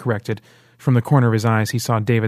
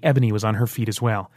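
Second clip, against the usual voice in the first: usual speaking voice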